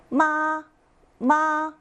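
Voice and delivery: 마 held high and level; first tone. Shown as a demonstration. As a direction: sustain each 마 on one high, even note. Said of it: "높고 평평한 소리였습니다".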